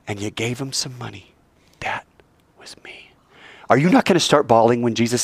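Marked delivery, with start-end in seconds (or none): 1.8-3.1 barely whispered, with a pause after the first word; fading into a whisper; hushed reveal. Acted. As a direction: whisper it as a tender revelation. Jesus softly reveals himself in imagined scene.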